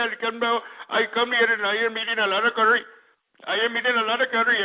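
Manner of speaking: Indian accent impression. Shown as a demonstration. Host showing caller a better Indian, mock Indian accent. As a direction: demonstrate an exaggerated Indian accent. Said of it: "imitate somebody who's Indian".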